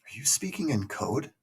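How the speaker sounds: ordinary talk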